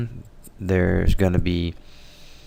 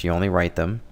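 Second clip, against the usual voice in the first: normal talking voice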